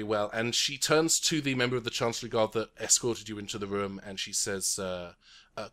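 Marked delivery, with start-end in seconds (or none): none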